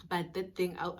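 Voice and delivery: ordinary talk, nothing marked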